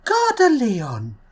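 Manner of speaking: slow Gare de Lyon that slides down; French announcement, falling tone. Pretend. A vlogger imitating the French two-tone station chime, falling. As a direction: start Gare high, then let the pitch fall away. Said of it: "two different voice tones".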